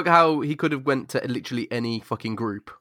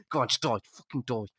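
menacing hush; 10 dB quieter, faster than usual. Retold or imitated. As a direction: drop to a quiet, sinister hiss. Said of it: "He's just like".

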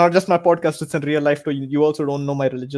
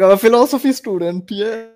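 raised, louder smug claim; smug student; 6 semitones higher, 5 dB louder than usual. Retold or imitated. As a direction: say it like it excuses everything. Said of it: "I can just be like".

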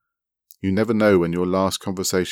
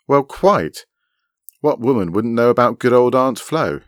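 higher and louder, pointed disbelief; incredulous; 6 semitones higher, 7 dB louder than usual. A narrator's reading. Woman doubting another woman's ignorance, incredulous. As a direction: question it as if absurd.

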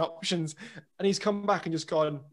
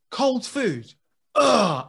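higher, loud disgusted groan; 8 semitones higher, 10 dB louder, much slower than usual. Retold or imitated. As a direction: spit out cold food like it's an insult. Sam disgusted leaving room.

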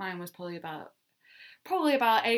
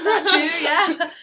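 laughing; 9 semitones higher, 12 dB louder, slower than usual. Real, not acted. Laughing agreement about Australian accents.

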